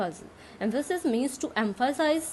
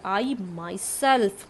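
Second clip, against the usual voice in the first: "myself" stretched out very slowly for emphasis; slow, deliberate "I myself", weight on "myself"; stress on myself; much slower than usual. Shown as a demonstration. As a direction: dwell on "myself" as the stressed word. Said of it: "किस पर ज़्यादा जोर डाला है".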